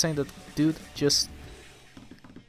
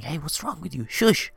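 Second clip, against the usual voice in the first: higher, hushed telling-off ending on a sharp shush; scolding little sister; 5 semitones higher than usual. Retold or imitated. Little sister comes out to the noisy visitors, scolding. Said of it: "little sister coming out and saying".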